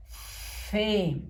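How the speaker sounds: "Fe" with a drawn-out hissing f; long hissed f. Shown as a demonstration. Teacher reads syllables to pupils, "Fe" with long hissed f. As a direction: hold the f of "Fe" like an angry cat. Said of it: "La f del gato enojado".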